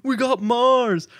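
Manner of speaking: high, drawn-out mock excitement; imitating hyped-up guy. Retelling a church video about Mars land, mock hype. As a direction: hype it up, stretching the words. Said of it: "all hyped about it".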